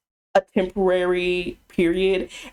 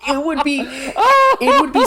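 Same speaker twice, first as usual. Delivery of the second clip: laughing; 8 semitones higher, 6 dB louder, slower than usual. Real, not acted. Laughing while talking.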